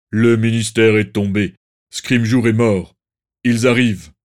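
grave, booming announcement; loud, solemn voice of an alarm messenger; Kingsley. A narrator's reading. A patronus warns the wedding guests that the Ministry has fallen, grave and loud. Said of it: "prit la voix grave et profonde de Kingsley".

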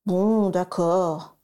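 slow sulky mumble; grumbling. A narrator's reading. Sirius grumbles at his aunt, sulkily. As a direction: grudgingly give in. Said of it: "ronchonna-t-il".

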